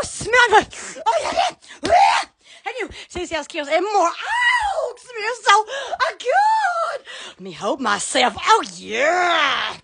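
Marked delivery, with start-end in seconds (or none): 4.1-7.0 ecstatic. Real, not acted. Savouring a smell.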